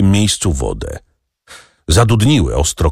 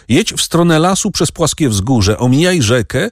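higher-pitched instructions, mildly called; lightly raised calling voice; 7 semitones higher than usual. A narrator's reading. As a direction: call directions to the boy without shouting. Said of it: "Andrzej zawołał".

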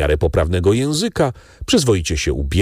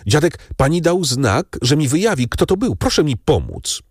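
higher, quick and hopeful; eager urgent plea; 7 semitones higher than usual. A narrator's reading. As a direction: hurry her along, the answer is so close. Franz urgently asks Valentine's help.

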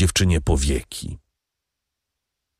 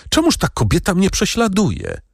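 cried out; 12 semitones higher than usual. A narrator's reading. Valentine asking the count why she's hunted, high cry. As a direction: lift the whole question up high in dismay. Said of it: "zawołała dziewczyna".

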